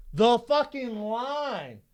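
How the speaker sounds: high, slow, drawn-out cry; retold yelling bartender. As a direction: stretch every word at the customer. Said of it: "We're yelling at each other now".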